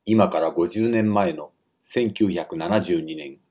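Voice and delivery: normal talking voice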